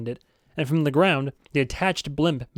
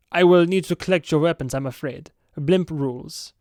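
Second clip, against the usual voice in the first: put-on light masculine Swedish accent, polite; a hint of a Swedish bodyguard asking for weapons; 3 semitones higher, 3 dB louder than usual. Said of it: "a masculine Swedish accent".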